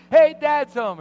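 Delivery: high, loud hammed-up greeting; cheery loud announcement. Dad announcing his arrival to the family, loud and cheery. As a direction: call it out big like a proud dad.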